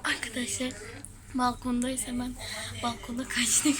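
hushed whisper. Real, not acted. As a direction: whisper to the camera. Hiding on balcony.

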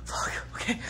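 breathy whispered curse under her breath; whispered aside. Real, not acted. Vlogger cursing to herself between takes, whispered. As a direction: drop to a whisper, just to yourself.

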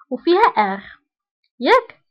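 ordinary talk, nothing marked

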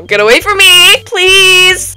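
high, loud panicked cry; panicked plea. Real, not acted. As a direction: beg them frantically to get away. Fleeing from bomb carrier.

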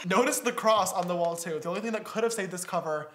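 ordinary talk

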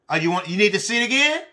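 ordinary talk, nothing marked

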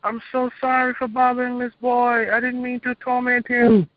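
high, slow begging wail of a demon; pleading demon. Retold or imitated. Demon begging its Master not to punish it, high pleading wail. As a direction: beg your master for mercy, way up high. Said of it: "was hollering".

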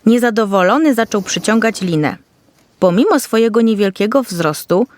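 ordinary delivery, nothing marked

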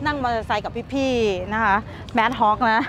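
usual speaking voice

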